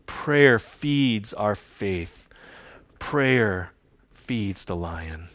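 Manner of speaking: very slow, weighty phrasing; slow emphatic. Real, not acted. Pastor driving home his point to the church, slow and weighty. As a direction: land each word slowly.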